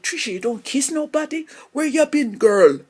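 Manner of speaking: loud, high West Indian auntie scolding playfully. Retold or imitated. Aunt teasing Trisha for not kissing anyone, loud West Indian accent. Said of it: "It's like".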